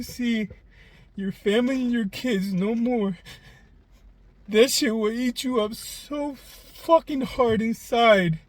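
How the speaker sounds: holding back tears while speaking of losing his family; emotional, near tears. Real, not acted. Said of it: "I'm so sorry for getting emotional".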